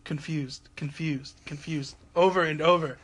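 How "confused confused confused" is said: soft ghostly repeating; eerie whisper. Retold or imitated. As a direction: breathe it like a ghost. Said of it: "a little girl saying".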